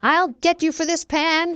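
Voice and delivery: high-pitched storybook yell; Hook's threat, mildly yelled. A narrator's reading. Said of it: "he yelled before falling".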